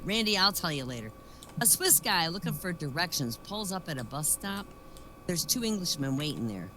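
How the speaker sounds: ordinary delivery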